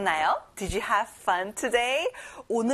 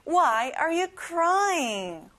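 very slow and higher Why are you crying; slower again, higher; 6 semitones higher, much slower than usual. Shown as a demonstration. Teacher repeats it slower and higher for the class. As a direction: lift it and stretch every word. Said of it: "한번 더 해볼게요".

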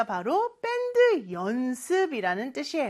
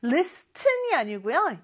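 ordinary delivery

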